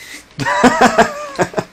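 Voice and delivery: high-pitched burst of laughter; real squealed laughter. Real, not acted. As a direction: laugh in a high squeal after being startled. Startled by shout.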